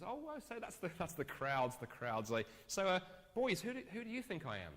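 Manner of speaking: string them along, then spring it; playful Jesus. Retold or imitated. Jesus turning to his disciples, teasing then pointed.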